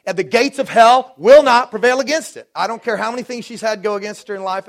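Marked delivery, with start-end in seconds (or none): 0.0-2.5 loud, high preaching climax; full preaching. Acted. Pastor proclaiming to his church, loud preaching. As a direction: proclaim it to the congregation.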